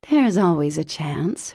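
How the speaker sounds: usual speaking voice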